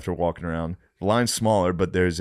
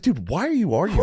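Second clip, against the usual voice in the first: high, laughing disbelief; baffled he won't drop it; incredulous; 11 semitones higher than usual. Retold or imitated. Quoting himself to the waiter, high laughing disbelief.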